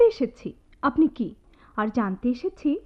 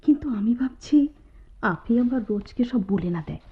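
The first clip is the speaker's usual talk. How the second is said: whisper; 3 semitones lower than usual. Acted. Whispering in class.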